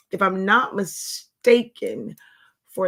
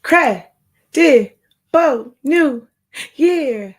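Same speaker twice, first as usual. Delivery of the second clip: drawn-out greeting; 5 semitones higher, 9 dB louder, much slower than usual. Acted. Sing-song livestream greeting.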